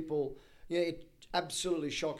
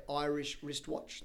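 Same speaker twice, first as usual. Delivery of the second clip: "Irish wristwatch" said slowly and carefully; tongue twister model; slower than usual. Shown as a demonstration. Guest offering host the next challenge, slow "Irish wristwatch". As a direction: introduce "Irish wristwatch" word by word, unhurried. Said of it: "three times fast".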